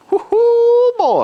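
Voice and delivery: loud, very high whoop of delight; a big happy woo-hoo; excited whoop. Real, not acted. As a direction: holler it out at the smell. Whooping at the smoke smell.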